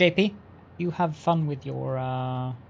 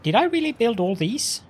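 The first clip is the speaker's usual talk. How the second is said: surprised; 9 semitones higher, 6 dB louder than usual. Real, not acted. Surprised at own big build.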